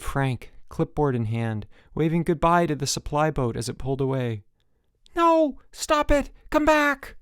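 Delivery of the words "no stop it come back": high, loud pleading shouts; desperate yelling. A narrator's reading. Counselor yelling at the supply boat to return. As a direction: yell after the departing boat.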